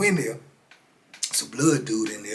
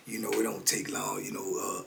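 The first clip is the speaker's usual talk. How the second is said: hushed aside; 3 semitones lower, 8 dB quieter than usual. Real, not acted. Aside while relaxing with coffee.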